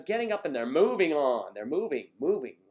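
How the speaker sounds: building, emphatic and loud on moving; rising excitement; excited. Real, not acted. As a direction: punch each moving harder, cheering them on. Enthusing about women moving on.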